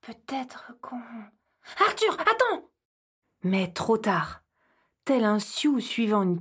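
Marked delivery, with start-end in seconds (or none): 0.0-1.0 very soft whisper; whisper, trailing off; hushed hesitant whisper. Acted. Hiding near the creature. 1.9-2.7 much higher urgent cry; high alarmed call. Acted. Calling after the boy.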